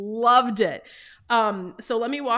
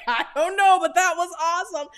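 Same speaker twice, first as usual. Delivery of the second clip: high, loud, thrilled exclaim after the ride; 8 semitones higher, 4 dB louder than usual. Retold or imitated. To her husband after the ride, thrilled and high. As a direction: burst out giddy and overwhelmed.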